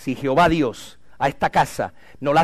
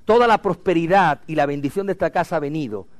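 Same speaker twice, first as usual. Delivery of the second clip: ordinary talk, nothing marked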